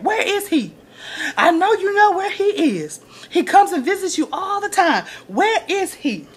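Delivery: high, pleading demand; imitating Olivia demanding. Olivia demanding from her mom.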